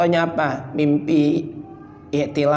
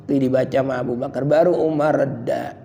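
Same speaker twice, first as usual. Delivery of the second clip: ordinary delivery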